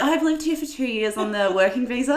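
mock an Aussie faking British, amused; hammed-up British impression with a laugh; put-on British accent. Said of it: "People really put it on".

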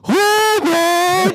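shrill shout of Robert; high shout. Acted. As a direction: yell the name high and loud. Acted calling out Robert.

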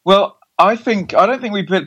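ordinary talk, nothing marked

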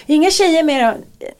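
high-pitched prying question; mock suspicious questioning. Panicking wife phoning her husband on the ski trail, mock suspicion.

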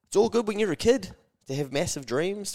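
usual speaking voice

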